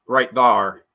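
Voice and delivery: Appalachian twang. Shown as a demonstration. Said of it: "is that Southern or is that Appalachian".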